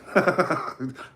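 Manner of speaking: chuckling at own joke; amused laugh. Real, not acted. Host amused by his mock hotel slogan, chuckling.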